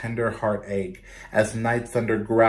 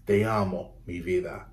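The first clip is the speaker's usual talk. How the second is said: tender grandfather; 4 semitones lower, 4 dB quieter than usual. Acted.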